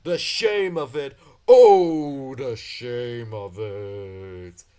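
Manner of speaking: slow, loud, melodramatic wail; wailing lament. Acted. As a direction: moan it like a tragedy. Gordon laments his trucks.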